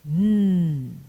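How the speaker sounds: "n" sustained as a voiced hum, tongue tip on the gum ridge; nasal n demo. Shown as a demonstration. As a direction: hum a steady "n" through the nose. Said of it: "The nasal".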